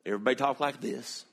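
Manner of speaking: a hint of a Southern accent; Southern drawl. Shown as a demonstration. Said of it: "Everybody had a drawl".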